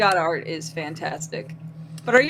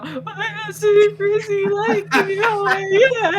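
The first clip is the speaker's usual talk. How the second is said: crying voice; 11 semitones higher, 4 dB louder than usual. Retold or imitated. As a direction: sob it out, barely holding on. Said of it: "that particular kind of crying voice".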